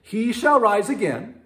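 high, loud insistence; Martha insisting. Retold or imitated. Martha answering Jesus about the resurrection, high and insistent. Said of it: "Martha said to him".

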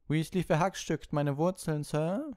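high, loud complaint to the teacher; tattling schoolboy. A narrator's reading. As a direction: tell on a classmate in a high put-on voice. Malfoy tattles to the professor.

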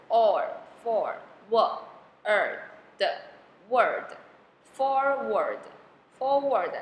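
"forward" built piece by piece, slow, long pauses between sounds; sounding out "forward". Shown as a demonstration. Teacher splitting two syllables for learners, slow sounding out. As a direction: break "forward" into or, for, word, then blend slowly. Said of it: "O R发or".

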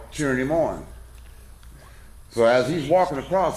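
ordinary talk

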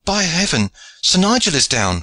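high, urgent cry of dismay; high-pitched alarmed exclamation; squire's alarmed cry. A narrator's reading. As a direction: cry out at a sudden disaster. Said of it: "cried the squire".

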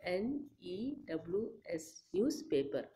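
"N E W S" spelled out slowly and softly; sounding out words. Shown as a demonstration. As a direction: spell "newspaper" letter by letter, quietly. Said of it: "One I'll show you".

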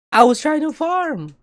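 dragging out the complaint; whiny complaint. Real, not acted. Streamer whining to chat about the lost fight.

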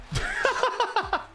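very high, staccato laughing fit; high cackle. Real, not acted. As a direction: burst into a squeaky laugh. Laughs at a runner thrown out.

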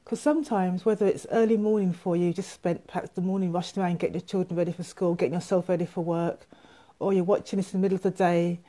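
ordinary delivery

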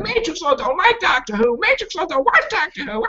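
high dopey whine of haters; mocking haters. Mocking fans who say he hates the show, high whine. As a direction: parrot them like a dumb chant. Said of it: "they're like".

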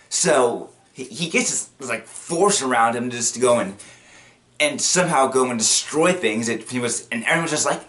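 usual speaking voice

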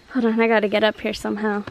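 ordinary talk

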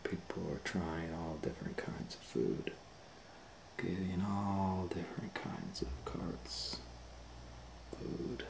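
hushed unvoiced whisper. Reading to children, whispering about the busy restaurant. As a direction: drop to a secret whisper.

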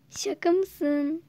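a loud, slow, drawn-out "Şaka mısın?"; can't believe what she heard; shocked disbelief. Acted. Girl hearing that her friend loves Berk too, loud slow disbelief. Friend reacts to crush news.

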